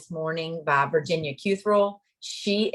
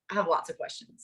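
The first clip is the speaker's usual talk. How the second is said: ordinary talk, nothing marked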